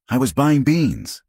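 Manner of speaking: put-on rising, upset young girl. A narrator's reading. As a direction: let the voice climb in protest. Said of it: "her voice rising".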